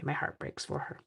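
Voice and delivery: whispered mock pity; a hushed, teasing heartbreak; mock heartbroken whisper. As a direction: breathe it out like fake sympathy. Mock sympathy aside.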